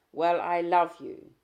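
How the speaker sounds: loud, slow angry I love you; angry declaration. Acted. Husband shouting his love at his wife. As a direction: bark it slowly.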